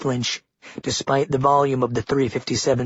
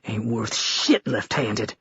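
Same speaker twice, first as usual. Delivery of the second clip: spitting angrily; 2 semitones lower, 3 dB quieter, slower than usual. A narrator's reading. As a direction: spit the words out in disgust. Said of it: "The governor spits angrily".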